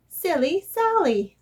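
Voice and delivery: ordinary delivery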